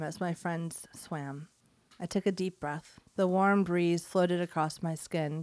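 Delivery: low, soft, measured reading voice; quiet, even literary narration; story narration. Acted. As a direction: read the story softly, pitched well down. Reads listener's story aloud.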